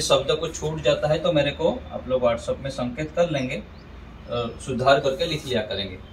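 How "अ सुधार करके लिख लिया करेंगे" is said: ordinary talk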